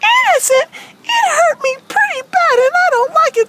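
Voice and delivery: high, affronted puppet voice; worked-up character complaint; indignant Wendy. Acted. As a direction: protest as a wronged cartoon girl. Wendy vows revenge.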